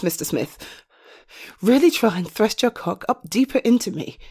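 ordinary delivery

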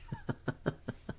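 very high breathy laugh; squealing giggle. Acted.

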